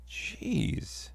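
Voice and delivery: breathed under the breath; a soft exasperated whisper; whispered jeez. Real, not acted. Muttered aside.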